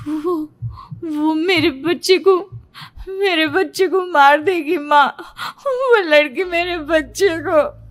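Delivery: shaky, crying and slow; tearful panic. A narrator's reading. Dazed daughter tells her mother through tears, slowed. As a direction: still sobbing from the nightmare. Half-awake daughter still terrified.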